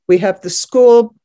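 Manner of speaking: ordinary talk, nothing marked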